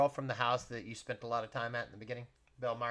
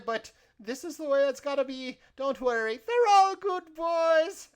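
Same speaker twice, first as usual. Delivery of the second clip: very high, loud falsetto character; kindly bustling cook; 12 semitones higher, 7 dB louder than usual. Acted. Boss handing over to the newcomers, high cheery voice. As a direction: introduce them in a piping, loud, reassuring voice.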